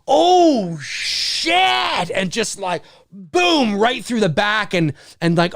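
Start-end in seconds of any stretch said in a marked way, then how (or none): 0.1-2.1 high, drawn-out exclamation; acted stunned viewer; shocked reaction. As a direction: stretch it out in horrified awe.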